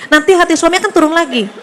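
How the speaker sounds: ordinary talk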